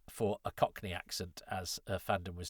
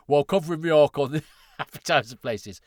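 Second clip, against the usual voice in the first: stupid voice, loud and pitched up; Richard Bignell; 5 semitones higher, 12 dB louder than usual. Retold or imitated. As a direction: daft mocking read, cracking into laughter. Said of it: "give Richard a stupid voice".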